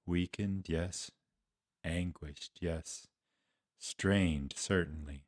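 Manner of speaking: hushed, low, halting grief; pained recollection. Acted. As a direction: dwell sorrowfully on each word. Grieving recall of dying friend's voice.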